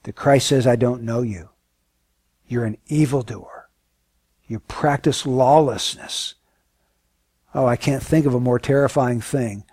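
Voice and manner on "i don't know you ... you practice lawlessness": a grave, hushed voice of judgment; hushed solemn verdict. Acted. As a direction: lower and softer, slowed at the end. Preacher voices Christ's judgment.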